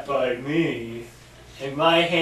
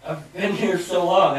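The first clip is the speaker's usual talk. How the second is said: ordinary talk, nothing marked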